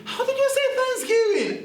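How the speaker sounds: put-on high, fast squeaky kid voice; mock kid question. Host mimicking a viewer, high and fast. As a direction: pipe it up like a puzzled kid.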